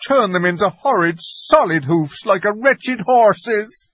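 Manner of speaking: high, crying faun voice; a frightened faun near tears; tearful faun. A narrator's reading. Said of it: "beginning to cry again".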